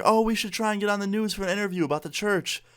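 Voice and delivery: high, enthusiastic mom quote; eager mom. Retold or imitated. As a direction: lightly imitate an eager mom. Friend's mom eager to be interviewed.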